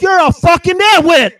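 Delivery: high, loud insult shouted in rage; retold furious yell. Yelling at the neighbor who hit him, furious high shout. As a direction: spit the insult right in his face. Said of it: "And I'm yelling this at him".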